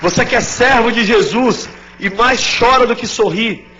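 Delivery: loud, high, urgent preaching; full-force preaching. Acted. Pastor exhorting the grieving congregation, loud and fervent. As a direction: preach it out to the whole crowd.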